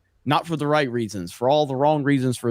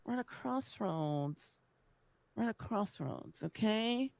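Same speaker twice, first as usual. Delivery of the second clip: far-off clip voice; 4 semitones higher, 12 dB quieter than usual. Acted. Clip of lispy rant.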